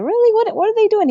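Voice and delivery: put-on very high, wide-eyed child's voice; amazed little girl. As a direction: squeak it out like a thrilled four-year-old. Child asking dad about wrestling.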